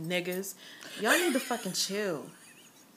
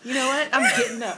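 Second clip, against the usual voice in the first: laughing; 3 semitones higher, 9 dB louder than usual. Real, not acted. Laughing, defiant.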